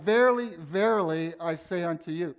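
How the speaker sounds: solemn emphatic "Verily, verily". Shown as a demonstration. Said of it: "It was like".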